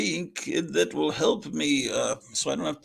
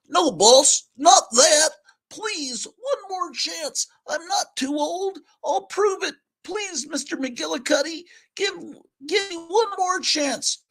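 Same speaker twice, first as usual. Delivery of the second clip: very high, begging cat voice; pleading old cat; 5 dB louder than usual. Acted. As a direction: beg the boss for one more chance, squeaky high.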